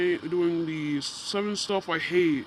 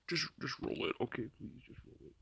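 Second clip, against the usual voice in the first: unvoiced whisper to the editor; 4 semitones lower, 15 dB quieter than usual. Real, not acted. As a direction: breathe it as a whisper.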